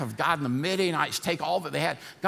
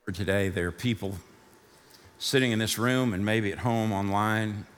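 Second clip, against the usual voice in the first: leading prayer; 4 semitones lower than usual. Acted. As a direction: pray gently for the listeners. Leads a prayer over music.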